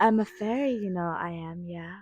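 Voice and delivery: lightly voiced fairy barmaid; a hint of a whimsical fairy; imitating Trisha as a fairy; mock fairy. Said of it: "she's like".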